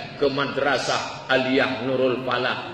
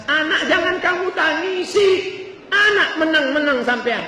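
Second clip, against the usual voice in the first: high-pitched imitated spousal telling-off; mock scolding husband; 12 semitones higher than usual. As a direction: play a husband protesting at his wife's tears. Husband scolds his crying wife. (suami menegur istri dengan nada tinggi)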